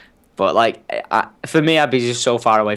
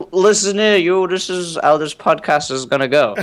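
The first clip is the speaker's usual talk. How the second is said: raised, higher mock-dad voice; bossy dad; 6 semitones higher than usual. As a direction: imitate dad barging in to take over. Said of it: "My dad will probably take over the live streaming and be like".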